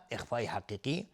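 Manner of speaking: ordinary delivery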